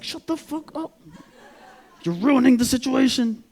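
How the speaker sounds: high, strained screech of rebuke; mock-shrieking, exasperated girlfriend; angry girlfriend. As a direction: screech at your boyfriend to shut up. Girlfriend snaps at him in panic.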